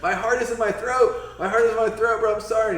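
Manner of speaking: high, loud frantic plea; pleading apology. Retold or imitated. The narrator begging Kyborg's forgiveness, high and frantic. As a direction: beg him, heart pounding.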